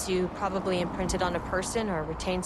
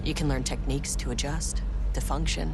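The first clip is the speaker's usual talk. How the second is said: low inner monologue; 8 semitones lower than usual. Acted. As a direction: talk yourself down, low.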